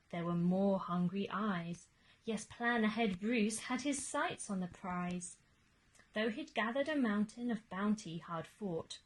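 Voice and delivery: ordinary delivery, nothing marked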